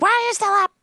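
high scolding mom; imitating mom catching her up late. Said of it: "my mom would be like".

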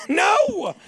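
high, loud "No!" through a laugh; laughing denial shout. Real, not acted. Comic yelping a denial to his cohost, loud and laughing. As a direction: yelp the denial. Denies having aids.

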